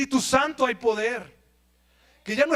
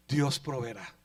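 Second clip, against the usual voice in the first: Dios proveerá murmured low and soft; quiet tense father; hushed, tense reassurance; 10 semitones lower, 7 dB quieter than usual. Acted. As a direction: hold back the fear, barely voiced. Father calms bound son.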